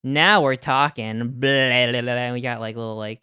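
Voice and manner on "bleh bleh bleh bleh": disgusted bleh. Pretend. Tongue-out face, now we're talking.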